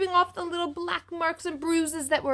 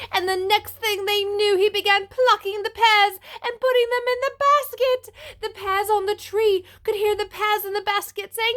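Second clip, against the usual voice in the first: building, higher and louder storytelling; rising excitement; 8 semitones higher, 6 dB louder than usual. A narrator's reading. Suspense climax of story.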